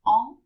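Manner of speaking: "en" as a nasal French vowel, said loud; nasal contrast. Shown as a demonstration. Teacher showing what the exception is not, nasal sound. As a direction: say "en" through the nose, no closed N. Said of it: "it isn't a nasal sound".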